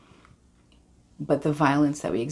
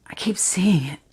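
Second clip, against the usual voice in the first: haunted whisper; 4 semitones higher than usual. Acted. Haunted memory in monologue.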